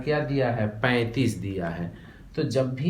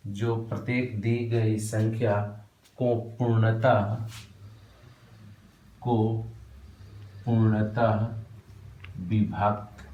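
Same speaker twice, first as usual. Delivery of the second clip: slow, broken into single words with pauses; slow dictation. Shown as a demonstration. Teacher dictating the definition slowly to students. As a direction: hand the words out one by one. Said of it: "write down करो".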